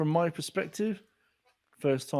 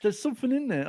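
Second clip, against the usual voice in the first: high excited exclaim; telling his friend he's in the book, high and excited; mock excited call; 10 semitones higher than usual. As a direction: squeal it up with excitement. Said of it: "phone you and go".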